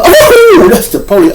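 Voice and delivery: high, loud startled ooh; a sudden hammed-up yelp of recognition; mock shock. Speaker spotting the police car, mock shock.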